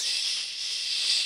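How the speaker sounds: hushing shh; shush. Retold or imitated. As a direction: silence him with a shh. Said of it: "puts his fingers up to Kurt's lips".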